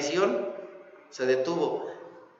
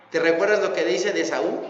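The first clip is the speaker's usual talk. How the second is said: normal talking voice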